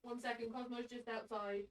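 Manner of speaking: far from mic. Real, not acted. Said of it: "I wasn't very close to the mic".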